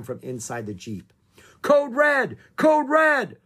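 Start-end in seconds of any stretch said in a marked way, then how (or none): 1.6-3.4 high, loud alarm call; urgent shout. A narrator's reading. Man's voice over the Jeep radio, shouting urgently. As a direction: shout an emergency alert, pitched far up. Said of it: "a man's voice shouted".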